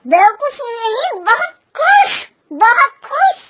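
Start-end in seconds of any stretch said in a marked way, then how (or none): 1.2-3.4 higher, pressing the word खुश; insistent correction. Acted. Mother-in-law corrects the misheard word, high insistent. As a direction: says खुश again, pushing it up high to correct.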